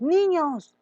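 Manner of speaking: put-on high, loud call to the children; teacher calling niños across the room. Shown as a demonstration. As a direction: call the kids to attention. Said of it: "Los vocativos".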